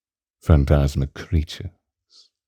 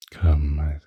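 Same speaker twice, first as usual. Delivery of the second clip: ordinary delivery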